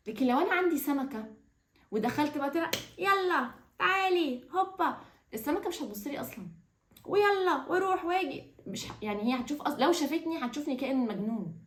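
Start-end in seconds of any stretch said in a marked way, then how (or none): 3.0-5.0 high, slow coaxing call to a pet; bright sing-song for a pet; playful pet call. Pretend. Calling a pet fish to play. 7.0-8.4 high, slow coaxing call to a pet; bright sing-song for a pet; playful pet call. Pretend. Calling a pet fish to play.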